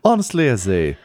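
mannered society gossip with swooping pitch; skull gossip columnist, intrigued. Acted. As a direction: relish it like an old society columnist.

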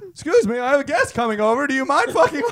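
high-pitched put-on protest; hammed-up polite outrage; mock indignant suspect. Acted suspect shooing away intruder.

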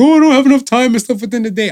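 high, fast, mock-complaining; whiny excuse. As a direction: mimic a lazy excuse. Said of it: "'cause people are like".

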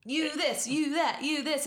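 high, mock childish parroting; kid parroting dad. Boy copying his father's swearing, high mock voice. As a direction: echo dad's words like a kid, pitched high. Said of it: "if it was like".

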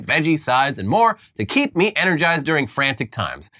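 upbeat sponsor read. Acted. Host reads the Factor sponsor spot, upbeat ad read. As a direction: sell it like a podcast ad.

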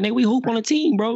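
incredulous retort. Retold or imitated. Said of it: "'cause I'm like".

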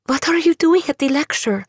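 put-on a scared girl confronting her; frightened young woman. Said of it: "Andula cried".

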